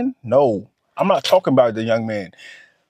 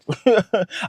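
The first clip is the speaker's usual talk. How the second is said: a quick real laugh at himself; short laugh; 6 semitones higher than usual. Real, not acted. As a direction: let a brief laugh slip out. Laughs at his own remark.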